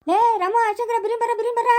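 loud, high call to come quickly. Acted. Calling the boys to come quick.

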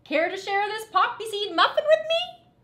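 high bright pigeon invitation; sweet pigeon. Acted. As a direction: offer it kindly like a pigeon. Pigeon offers muffin.

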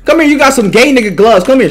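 ordinary talk, nothing marked

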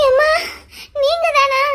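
tearful, catching voice; crying. Acted. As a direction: say it through sobs after a fright. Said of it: "எதுக்கு அழுதுட்டு இருக்க".

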